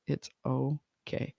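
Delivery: quiet, slow and gentle; slow soft reassurance. Shown as a demonstration. Host comforting a new mother, slow and soft. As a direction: ease her worry slowly and softly. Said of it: "what would I want to whisper her".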